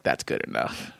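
low breathy aside; low whisper. Retold or imitated. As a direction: mutter it low and unvoiced. Coach's dismissive reply.

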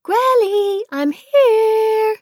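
put-on high, loud child calling through a door. Little girl calling grandma at the door, loud and high. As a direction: shout for grandma, high and bright.

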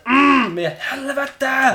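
imitated engine hum bursting into a curse; loud, high, drawn-out mock swearing; motor noise and cursing. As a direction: rev like a motor, then howl the curse. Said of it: "imiterade motorljudet".

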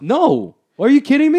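high, loud disbelief; real astonished refusal; incredulous. Real, not acted. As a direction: shoot the idea down, voice leaping up. Reacting to absurd dog-fight question.

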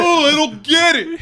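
high, loud frustrated whine; mock frustration. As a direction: whine it like a baffled detective.

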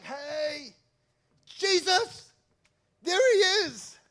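high, loud hail to someone just spotted; excited fans calling out. Acted. Crowd catches up with Jesus, hailing him excitedly. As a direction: call out like an adoring crowd.

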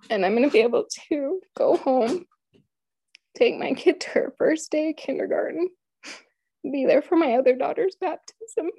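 high, tearful voice; holding back real tears, pitch rising; choked up. Real, not acted. Imprisoned mother hoping to go home, choked up. Recalling hopes of going home, overcome.

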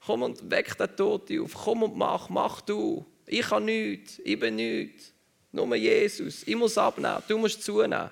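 high, pleading self-abasing cry; prophet crying to God. Retold or imitated. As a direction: beg God like a desperate prophet. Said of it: "Propheten zu Gott schreien". (hoch und flehend, sich klein machend)

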